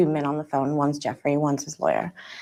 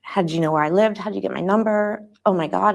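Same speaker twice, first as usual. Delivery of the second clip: usual speaking voice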